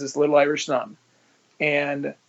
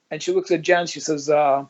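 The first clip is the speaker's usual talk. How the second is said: ordinary talk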